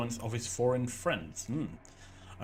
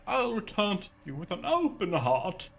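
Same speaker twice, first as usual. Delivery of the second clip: mushy love letter voice; 6 semitones higher, 4 dB louder than usual. Acted. Reading a love letter aloud.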